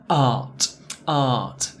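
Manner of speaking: art with a long open ah. Shown as a demonstration. Teacher isolates the vowel in art. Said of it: "where the vowel sound was".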